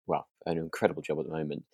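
ordinary talk

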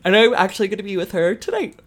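high, stretched voice through a yawn. Real, not acted. Host sharing tonight's plans, yawning through it. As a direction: let a yawn swallow the words. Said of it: "Sorry, I yawned".

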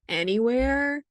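ordinary delivery

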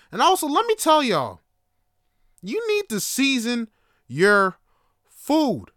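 loud, high, punched-out rant; emphatic lecturing. Real, not acted. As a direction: preach it to the crowd, word by word.

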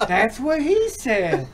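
put-on slow, laughing Virginia accent. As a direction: drawl it out while laughing. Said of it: "in his Virginia accent".

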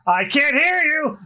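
high, drawn-out drill call; slow, high-pitched mock shout; captain's shout. Captain demands louder answer from crew.

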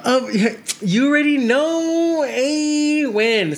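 thrilled at the invite; excited. Real, not acted. Invited to the trip.

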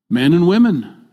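ordinary talk

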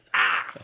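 sharp loud cry of pain; pained yelp. Retold or imitated. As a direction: yelp like a pulled hamstring. Mimics a hamstring injury.

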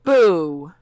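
big, loud jeer; loud boo. Acted. Writer echoes Louis rejecting truth, loud boo. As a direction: boo it out like a heckler.

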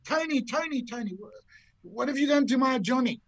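high-pitched imitation of an alarmed wife; frantic wife. Wife charges down the tee at golfer, frantic. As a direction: call out in mock panic, pitched right up. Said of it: "She comes charging down the tenth tee".

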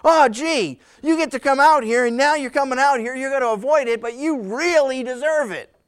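high-pitched sarcastic contempt; mock them with scornful disbelief; acted sneer at undeserving latecomers; disgusted. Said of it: "You can almost hear the disgust in his voice".

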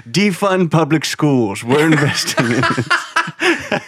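loud, slow presidential caricature, part Reagan; Donald Trump. Acted. President ordering space spending, loud slow caricature. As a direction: proclaim it slowly, hammed-up. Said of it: "a Trump Reagan accent".

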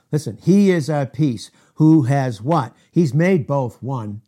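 loud, driving preacher cadence; forceful preaching. Real, not acted. Preacher driving the point home to his congregation, loud and forceful.

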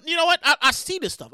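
ordinary delivery

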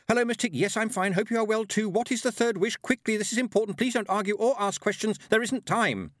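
high, loud, breakneck plea; urgent shout. A narrator's reading. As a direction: shout it all in one frantic rush. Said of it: "pulled her around and shouted".

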